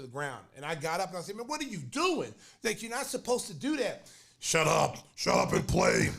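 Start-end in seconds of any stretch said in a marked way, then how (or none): 1.4-4.1 higher-pitched indignant complaint; retold protest. Retold protest after hard foul. 4.4-6.1 loud, rough brush-off; mock angry command; retold angry shout. Opponent snapping back in retold fight.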